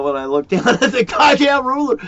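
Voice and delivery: laughing high and loud through the words; can't hold back the laugh, pitch jumping up. Real, not acted. Telling a friend about the roulette bet, laughing loudly.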